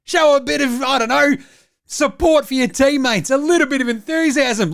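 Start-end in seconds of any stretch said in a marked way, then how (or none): none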